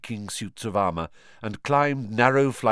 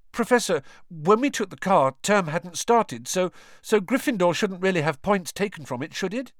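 anxious; 10 semitones higher than usual. A narrator's reading. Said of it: "watching her anxiously".

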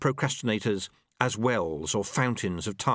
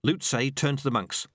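plain reading voice

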